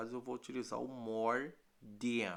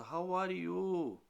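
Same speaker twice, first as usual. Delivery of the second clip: slow, higher sing-song how are you; mock asker; 8 semitones higher, 3 dB louder, much slower than usual. Shown as a demonstration. Teacher acting a friend asking, slow and higher. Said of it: "quando alguém pergunta".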